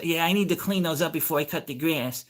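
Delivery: high put-on neighbor voice; imitating old lady. Old lady explaining yard chore to neighbor, mimicked high. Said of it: "She goes".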